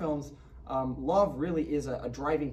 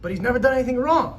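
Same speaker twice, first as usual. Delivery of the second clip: loud high protest; 11 semitones higher, 11 dB louder than usual. Acted. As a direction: plead his innocence. [name] protesting in clip.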